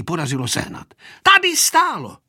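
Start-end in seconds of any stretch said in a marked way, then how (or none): 1.2-2.2 put-on high, insistent old woman; astonished granny showing the spot; agitated grandmother. A narrator's reading. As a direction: burst out high, then draw it out. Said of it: "ukazovala babička a prst se jí třásl".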